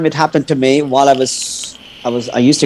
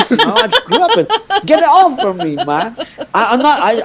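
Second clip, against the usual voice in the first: laughing; 11 semitones higher than usual. Real, not acted. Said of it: "laughing while telling".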